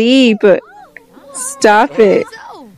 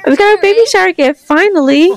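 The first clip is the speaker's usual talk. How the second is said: high, quick delighted announcement; pleased, pitched-up outburst; excited; 10 semitones higher, faster than usual. Real, not acted. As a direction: celebrate it with a raised pitch. First gift arrives in game.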